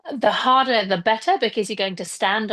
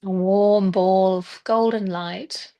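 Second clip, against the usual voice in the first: guided meditation. Acted. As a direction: ease them gently into stillness, leaving space between phrases. Leads a breathing and visualization meditation.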